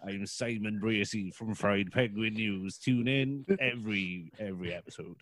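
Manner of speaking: Simon Bracy. Acted. Host impression introducing Fried Penguin News, as Simon. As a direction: drag out the show intro, sinking low and soft at the end. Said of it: "that's an amazing Simon impression".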